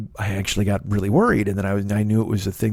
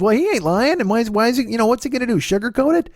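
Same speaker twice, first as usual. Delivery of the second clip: much higher mom voice, matter-of-fact; imitating mom; 12 semitones higher than usual. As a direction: pitch it high as blunt mom. Mom voiced defending doctor.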